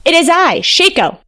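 high-pitched announcing call; storybook fox hailing someone. A narrator's reading. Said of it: "Shako called".

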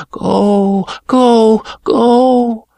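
high, loud, rising urging; ringing sword crying go; urgent sword voice. A narrator's reading.

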